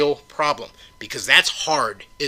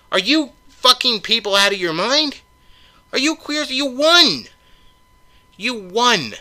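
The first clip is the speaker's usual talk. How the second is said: angry yelling; 6 semitones higher than usual. Real, not acted. Ranting at liberals, yelling.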